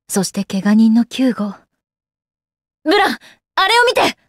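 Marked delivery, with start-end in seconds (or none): 2.8-4.2 high, alarmed call-out; sudden high-pitched cry of alarm; urgent call. Acted. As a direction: call out sharply to a companion. Urgently calls Blanc to look.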